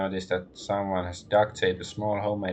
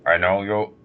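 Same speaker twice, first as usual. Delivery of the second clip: troll voice; 7 dB louder than usual. Acted. Troll greets the party.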